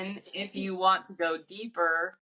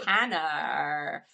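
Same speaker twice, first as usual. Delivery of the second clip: put-on Valley Girl voice; 4 semitones lower than usual. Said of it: "call their son his name in a Valley Girl voice".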